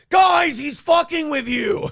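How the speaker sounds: slow, high, loud mock shout. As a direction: yell it out as Owen, hammed up. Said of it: "he's like".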